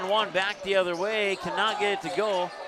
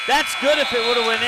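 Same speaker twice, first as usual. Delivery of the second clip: excited call; 5 semitones higher, 9 dB louder, faster than usual. Real, not acted. Near miss at the buzzer.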